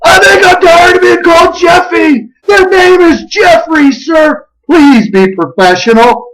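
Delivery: high, shouted outburst of indignation; explosive anger. Retold or imitated. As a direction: act out a man blowing up. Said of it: "That's when this guy explodes. He blows up".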